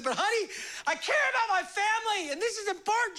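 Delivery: high, laughing whine; husband whining to his wife, high and laughing; mock whiny plea. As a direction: beg the wife like a kid. Pleading with wife.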